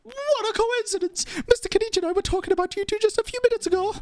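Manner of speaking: high, chatty character with a put-on accent. Reporter greeting the Traveler and Paimon, high and accented. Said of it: "I don't know why I'm doing that accent".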